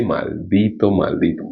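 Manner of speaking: a soft, low "maldito" repeated; quiet grave curse. Shown as a demonstration. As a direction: hush it down on "maldito, maldito". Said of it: "Dice así".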